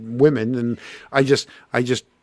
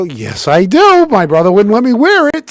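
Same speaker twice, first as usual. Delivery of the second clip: eager answer; 8 semitones higher, 6 dB louder than usual. Retold or imitated. Answering girl about jacket.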